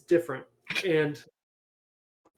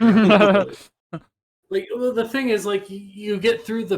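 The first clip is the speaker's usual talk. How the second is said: loud high burst; emphatic; 7 semitones higher, 10 dB louder than usual. Real, not acted. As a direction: blurt it out emphatically. Loud correction, half is fun.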